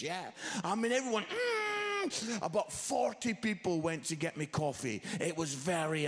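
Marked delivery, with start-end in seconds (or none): 1.2-2.4 high, tense held hum; mock clenching squeal. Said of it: "a synchronized buttock clench".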